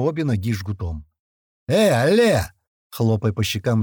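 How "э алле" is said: loud, high call; shouting to rouse. A narrator's reading. Fighter slapping the unconscious captive's cheeks, shouting to rouse him. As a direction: call sharply to wake him. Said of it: "прокричал Грач".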